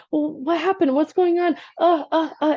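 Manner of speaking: mock panicked fluster; spinning in panic. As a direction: act out someone spiralling, ending in stammered uhs. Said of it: "most people start to spin".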